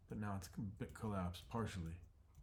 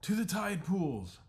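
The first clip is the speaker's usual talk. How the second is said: loud, slow, much higher rallying cry; commanding shout; 11 semitones higher, 9 dB louder, slower than usual. Acted. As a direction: bellow the order to the whole flock. Chief orders flock to flee.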